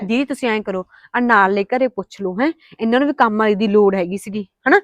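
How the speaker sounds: loud pleading with the man; loud urging. Acted. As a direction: insist he ask their house.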